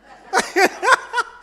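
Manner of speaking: a real laugh that shoots up high; bright real giggle at the story; high burst of laughter. Real, not acted.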